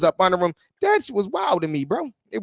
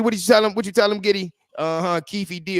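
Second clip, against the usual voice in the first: ordinary delivery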